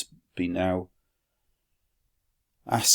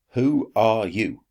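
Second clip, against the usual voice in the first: louder question; 3 semitones higher, 6 dB louder, slower than usual. Acted. Metella asks own slave.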